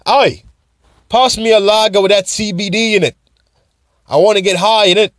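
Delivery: high-pitched, badly faked Scottish pub voice; mock a Scottish punter ordering a pint; bad Scottish accent. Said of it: "That was my Scottish accent. That was bad".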